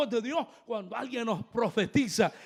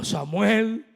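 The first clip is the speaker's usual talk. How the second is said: raised-voice call of a name; 9 dB louder than usual. Acted. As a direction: call the sleeper by name, loudly. Acts God calling Samuel by name.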